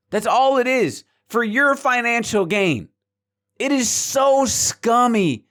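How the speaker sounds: high, exasperated emphatic rant; real exasperation, pitched high and drawn out; disgusted rant. Real, not acted. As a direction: vent in disgust, stretching the key words. Ranting about manipulative creators.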